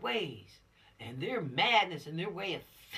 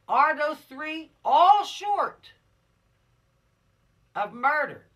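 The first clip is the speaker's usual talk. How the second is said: slow, much higher and louder, pressing each word; emphatic loud question; 10 semitones higher, 14 dB louder, much slower than usual. Real, not acted. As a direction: drive the question home to the class. Teacher stresses a question to the class.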